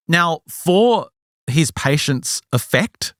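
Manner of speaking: ordinary talk, nothing marked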